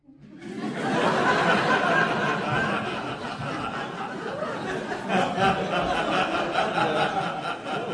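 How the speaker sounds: big real belly laugh; loud long laugh. Real, not acted. Recalling his own cheeky question, a long loud laugh.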